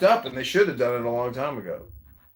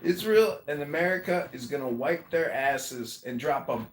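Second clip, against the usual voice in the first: normal talking voice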